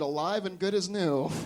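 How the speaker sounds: plain reading voice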